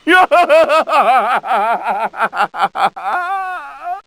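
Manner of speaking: long rising maniacal cackle; villain cackling in victory; triumphant evil laugh. Acted.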